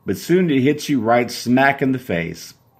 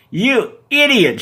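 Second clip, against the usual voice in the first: high, loud, slow furious shriek; furious yell; 12 semitones higher, 4 dB louder, slower than usual. Acted. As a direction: yell it right in his face. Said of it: "she yells straight to your face".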